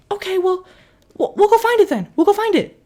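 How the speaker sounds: put-on very high kid voice; high-pitched kid reassurance; squeaky kid. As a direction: promise like an earnest little boy.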